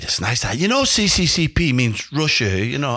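a hint of the old boss's accent; Sir Bobby. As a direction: explain it knowingly in the gaffer's accent. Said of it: "I'll do his accent".

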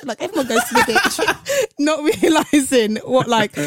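cracking up while telling it; laughing. Real, not acted. Laughing at her childhood blunder.

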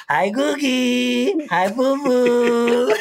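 put-on slow, high cooing baby talk. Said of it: "now I'm just like".

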